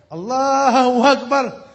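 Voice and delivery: desperate cry to God in a dream; shouted cry. Retold or imitated. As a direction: cry it out with all your heart, slowly. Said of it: "je commence à crier de tout mon cœur".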